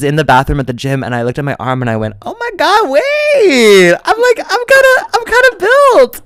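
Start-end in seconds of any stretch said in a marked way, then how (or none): 2.2-6.2 very high, loud squeal of self-admiration; thrilled squeal. Retold or imitated. Admiring own arm in the gym bathroom, thrilled squeal. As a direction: squeal it like you just amazed yourself.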